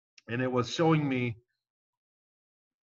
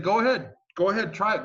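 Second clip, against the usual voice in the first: taunting dare; 8 semitones higher, 4 dB louder than usual. Retold or imitated. Taunting guard to defy them.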